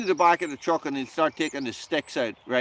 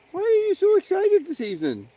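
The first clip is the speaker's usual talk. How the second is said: pet talk. Real, not acted. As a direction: tease the excited dog in a high voice. Playful high talk to dog.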